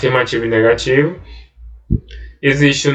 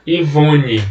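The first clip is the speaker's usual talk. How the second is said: ordinary talk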